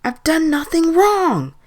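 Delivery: high, loud, drawn-out scream; screamed protest. A narrator's reading. Old man denying guilt to the voices, screamed. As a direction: scream your innocence, high and slow. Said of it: "Peter screamed".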